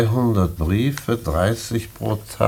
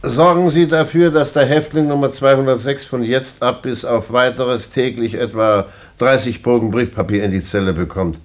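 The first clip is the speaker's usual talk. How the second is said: calling out orders; 4 semitones higher than usual. Acted. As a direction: call the inspector in and give the order. Director calls orders to inspector.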